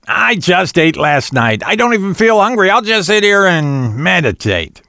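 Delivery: high-pitched demon insisting on innocence; demon Ketch. Acted. Voices the demon Ketch protesting.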